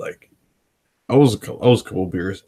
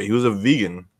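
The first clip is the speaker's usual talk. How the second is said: ordinary delivery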